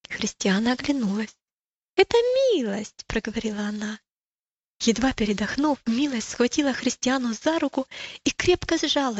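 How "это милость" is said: high, delighted exclamation; acted cry on recognizing a friend; excited recognition. A narrator's reading. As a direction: exclaim it on a high held pitch. Christiana spots her friend running up.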